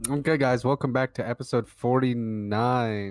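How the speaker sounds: podcast intro. Acted. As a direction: announce the episode like a host.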